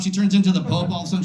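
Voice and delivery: ordinary talk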